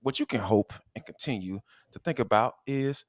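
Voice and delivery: ordinary delivery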